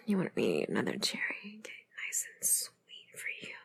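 sultry whisper. Acted. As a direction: whisper it close and intimate. Whispered intimate roleplay.

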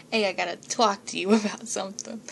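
put-on thick New York or Jersey accent, laughing through it. Hamming up a New York accent for her listeners. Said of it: "I have a New York accent".

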